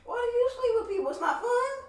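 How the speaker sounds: high, loud laughing shriek; laughing shout. Real, not acted.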